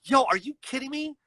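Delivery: high-pitched retold indignation; getting mad. Retelling his reply to dispatch about the address, getting mad. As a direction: protest in exasperated disbelief. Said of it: "I started getting mad".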